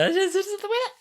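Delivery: high-pitched camp alien fretting; Alpha Centauri. Acted. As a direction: squeal in goofy alien alarm. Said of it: "someone doing a high-pitched v-, voice".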